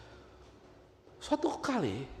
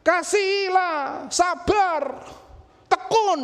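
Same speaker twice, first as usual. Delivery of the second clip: higher, louder, drawn-out preachy "Kasihlah"; mocking preacher; 7 dB louder, much slower than usual. Angel Samuel mocks the pastor's easy preaching, hammed up. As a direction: ham up a sanctimonious pastor sermon. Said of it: "Enak pendeta ini ngomong".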